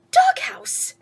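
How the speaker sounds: a sudden high squeal of the word; squeaky high echo. Acted. Skippy echoing mama, high squeak. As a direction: yelp it up high.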